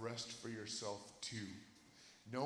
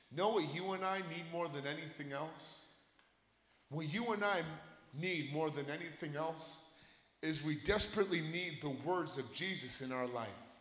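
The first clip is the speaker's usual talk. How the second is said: loud, high, impassioned preaching; fired-up sermon climax; full-force preaching; 10 semitones higher, 17 dB louder than usual. Acted. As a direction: preach it to the back row.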